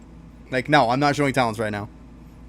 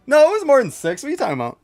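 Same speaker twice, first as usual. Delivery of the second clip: excited protest; 12 semitones higher, 5 dB louder, faster than usual. Real, not acted. Arguing about time left.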